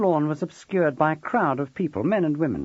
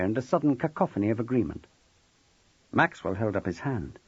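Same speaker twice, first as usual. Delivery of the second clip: plain reading voice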